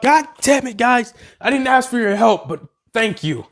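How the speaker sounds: put-on a frustrated girl telling her rescuers off; exasperated heroine. Character snaps at helpers.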